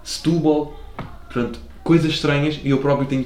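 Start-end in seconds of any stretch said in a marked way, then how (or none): 0.0-0.8 "Setúbal" squashed to "S'túbal"; swallowed syllable. Shown as a demonstration. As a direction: drop the e in "Setúbal". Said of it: "come sílabas em tudo o que é palavra".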